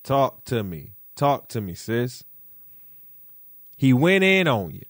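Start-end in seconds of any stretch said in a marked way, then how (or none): none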